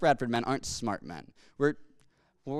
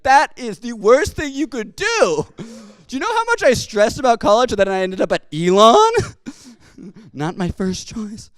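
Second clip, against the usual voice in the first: much louder and higher, slowed, incredulous; outraged disbelief; 8 semitones higher, 10 dB louder than usual. Retold or imitated. Grandson protesting the grandfather's college pick, loud and appalled. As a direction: blurt it out, aghast at his choice. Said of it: "And I was like".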